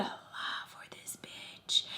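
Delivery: slow secret whisper to the viewers. Real, not acted. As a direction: lean in and whisper it, slowly. Confiding aside to viewers.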